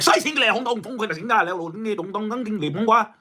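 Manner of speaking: lightly done mock Chinese sounding gibberish. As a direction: fake Chinese gibberish, half-hearted. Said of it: "Some languages be funny as hell".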